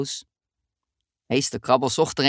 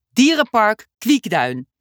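slow proud announcement; 5 semitones higher, 5 dB louder, slower than usual. Acted. Teacher announces the destination.

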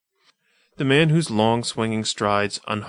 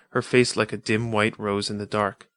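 plain reading voice

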